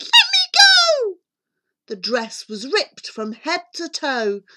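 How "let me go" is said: high, loud shriek; shouted demand. Acted. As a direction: shriek to break free. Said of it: "As Cindy shouted".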